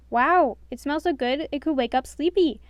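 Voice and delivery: delighted exclaim; excited. A narrator's reading. Doc smelling breakfast, excited. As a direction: gush over the food. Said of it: "Doc exclaimed".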